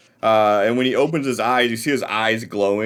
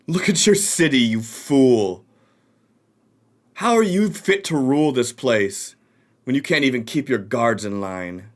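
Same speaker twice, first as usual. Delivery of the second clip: mocking rival. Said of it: "you hear this voice".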